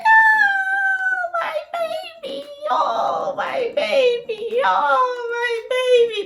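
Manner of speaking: high, loud, slow sobbing cry. Retold or imitated. New mother crying over her newborn on her chest, high and slow. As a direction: wail it through happy tears. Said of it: "while I was crying".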